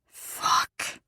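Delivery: breathy hiss; hissed curse. A narrator's reading. Lover reacting as she shifts beneath him, hissing. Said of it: "he hissed".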